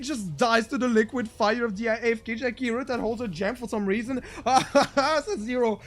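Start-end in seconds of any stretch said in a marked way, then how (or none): 4.3-5.8 laughing. Real, not acted. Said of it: "I'm not laughing, I'm crying".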